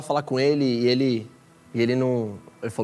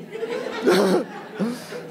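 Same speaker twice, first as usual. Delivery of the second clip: breathy, high burst of laughter; delighted laugh; 8 semitones higher than usual. Real, not acted. Retelling his message to the DJ, delighted laughter. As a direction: laugh at the miracle.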